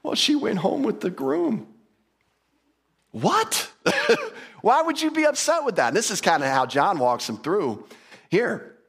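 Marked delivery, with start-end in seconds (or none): 0.0-1.7 high-pitched pretend weeping; mock crying. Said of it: "they're all crying". 3.1-5.9 high-pitched mock disbelief; hammed-up astonished question; incredulous. Acted reply to crying wedding guests.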